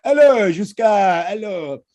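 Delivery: slow, drawn-out high hello; mock French greeting. To his friend, mocking locals greeting him, drawn-out hello.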